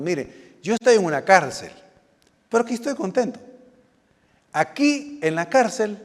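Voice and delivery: emphatic, lightly voiced letter writer; voicing the apostle Paul. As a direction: lightly play a contented man writing from jail. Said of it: "estoy interpretando lo que el, el, el apóstol está diciendo".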